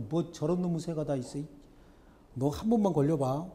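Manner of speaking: ordinary talk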